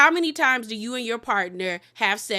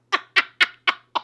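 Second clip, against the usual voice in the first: breathy laugh; 7 semitones higher, 4 dB louder than usual. Real, not acted. Host laughing at awkward scene.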